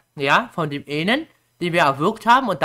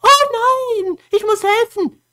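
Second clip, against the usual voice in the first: high, drawn-out mock wail; hammed-up cry of alarm; acted alarmed thought; 5 dB louder, slower than usual. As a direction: squeal it like a panicked rescuer. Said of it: "denkt sich nur".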